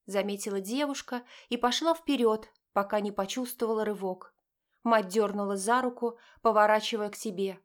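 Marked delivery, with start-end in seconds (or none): none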